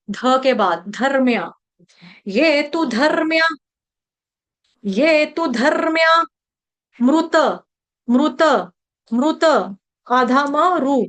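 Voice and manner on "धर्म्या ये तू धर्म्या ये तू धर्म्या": धर्म्या with the र before म्या clearly sounded; careful pronunciation. Shown as a demonstration. Teacher modelling धर्म्या for devotees, slow and clear. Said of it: "रफार वाला र बोला जाएगा".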